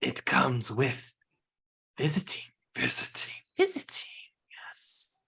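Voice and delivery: eerie repeated whisper; creepy whisper. Acted. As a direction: whisper it like an echo. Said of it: "in their creepy voice".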